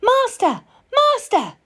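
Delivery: high, piping alarm call. A narrator's reading. Harp calling to the sleeping giant, high and urgent. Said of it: "the harp called out".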